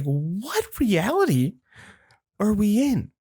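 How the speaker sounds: high, slow, stretched incredulity; mock disbelief. Grandson to grandma, hammed-up stunned disbelief. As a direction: drag it out as if reality broke.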